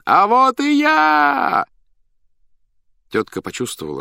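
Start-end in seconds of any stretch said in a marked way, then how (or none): 0.0-1.7 very high, drawn-out clown's holler; loud shout. A narrator's reading. Master announces himself to the circus crowd, loud shout. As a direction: cry it out slowly at full voice, way up high. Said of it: "громко крикнул хозяин".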